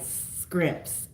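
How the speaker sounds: "scrimps" with skr for shr; husband's Louisiana pronunciation. Retold or imitated. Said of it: "so there's an accent there".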